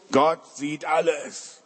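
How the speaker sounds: very slow, solemn parrot squawking its phrase; the parrot repeats it slowly and gravely. Acted. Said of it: "Und der Papagei sagt so". (sehr langsame, feierliche Papageienstimme)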